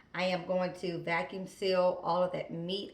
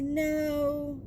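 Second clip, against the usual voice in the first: daughter's flat no; 7 semitones higher than usual. Retold or imitated. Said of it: "And this is how she talks".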